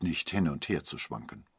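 ordinary delivery

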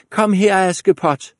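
stepsisters shout high and loud; bossy high yell across the house; calling out. Acted. Stepsisters summon Askepot, a high bossy shout. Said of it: "råbte de".

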